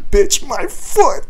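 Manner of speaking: high, drawn-out mock cry of pain; slow, high-pitched retold yelp; pained outburst. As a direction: act out agonised, strangled protest. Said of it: "from the pain, I can't speak".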